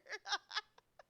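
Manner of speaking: high loud laugh. Real, not acted. Gamer laughing at own outburst, high laugh.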